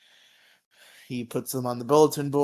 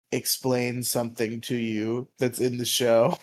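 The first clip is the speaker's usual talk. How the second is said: normal talking voice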